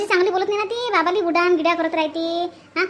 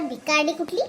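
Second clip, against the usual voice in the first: ordinary delivery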